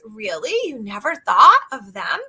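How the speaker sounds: normal talking voice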